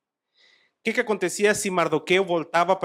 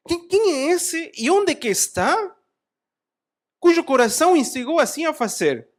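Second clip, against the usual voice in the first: the king roars in disbelief at Esther; furious king; 11 semitones higher, 6 dB louder than usual. Acted. King furious at Esther, shouting in disbelief.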